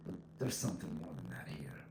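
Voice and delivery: soft whisper, musing to yourself; suspicious inner thought. Retold or imitated. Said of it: "You just think".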